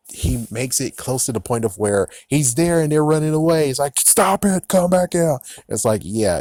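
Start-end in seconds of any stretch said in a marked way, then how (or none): 4.0-5.4 high, loud call after fleeing robbers; mock yell. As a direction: shout them back, half-acted. Retelling a scene, yelling at robbers.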